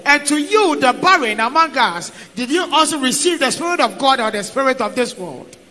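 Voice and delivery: normal talking voice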